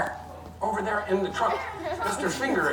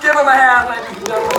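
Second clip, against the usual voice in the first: loud, higher showman's shout; calling for applause; 4 semitones higher, 10 dB louder than usual. Real, not acted. Juggler thanking his volunteer before the audience, loud call. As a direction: belt it out to a cheering crowd.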